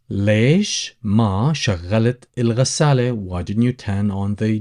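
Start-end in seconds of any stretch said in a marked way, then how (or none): 0.1-3.1 ليش ما شغلت الغسالة drawn out slowly for learners; slow, careful teacher repeat. Shown as a demonstration. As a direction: say it again slowly so students can follow.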